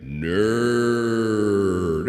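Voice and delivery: "Nerd" drawn out long with a big echo. Shown as a demonstration. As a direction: say "Nerd" slowly, as if into an echo chamber. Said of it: "I will give you echo".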